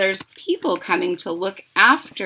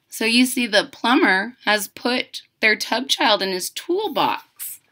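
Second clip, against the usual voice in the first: ordinary delivery, nothing marked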